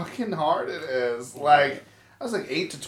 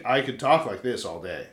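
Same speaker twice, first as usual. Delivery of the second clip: lower-pitched talking voice; usual deeper voice; 5 semitones lower than usual. Shown as a demonstration. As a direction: drop into a low, relaxed register. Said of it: "'cause usually I c".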